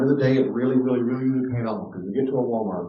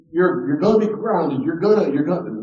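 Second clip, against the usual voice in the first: raised, higher scolding voice; worked-up grounding threat, acted; empty parental threat; 6 semitones higher, 6 dB louder than usual. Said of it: "so they know if you say".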